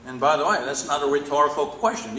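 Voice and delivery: ordinary talk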